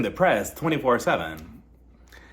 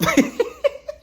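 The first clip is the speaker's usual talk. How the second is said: high squeal of laughter; shrieking laugh; 4 dB louder than usual. Real, not acted. Laughing at own joke.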